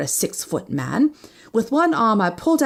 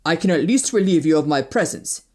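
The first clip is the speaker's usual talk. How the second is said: lightly voiced proud man; curt stranger; 3 semitones lower than usual. Wounded man offers to leave, curtly. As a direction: answer stiffly in a man's voice.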